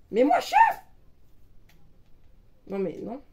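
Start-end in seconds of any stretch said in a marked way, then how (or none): 0.1-0.8 very high shrill plea; squealing demand. Real, not acted. Begging to be made leader.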